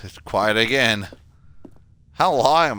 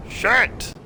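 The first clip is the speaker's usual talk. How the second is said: a sudden high yelp; startled curse; 12 semitones higher than usual. Real, not acted. Player sees the plant break, high startled curse. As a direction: blurt it out in shock.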